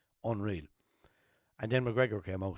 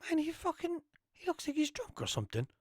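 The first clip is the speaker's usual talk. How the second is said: high, softer voicing of himself; high retold quote; 4 dB quieter than usual. His own version of what he said, high and puzzled. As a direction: wonder aloud, pitched up. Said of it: "the way I remember it was I said".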